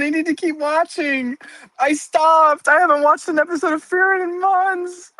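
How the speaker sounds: high, theatrical whining to herself; whiny lament. Pretend. Streamer wailing to chat about missing Frieren, high whiny lament. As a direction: wail like a tragic heroine missing her show.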